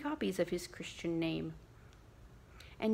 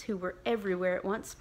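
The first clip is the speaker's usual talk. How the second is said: plain reading voice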